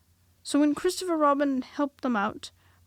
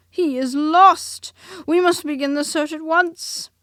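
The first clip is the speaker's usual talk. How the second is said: higher, much louder alarmed call; urgent Rabbit; 6 semitones higher, 13 dB louder, slower than usual. A narrator's reading. Rabbit rallying Christopher Robin, loud high urgent call. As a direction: sound the alarm like a fussy Rabbit.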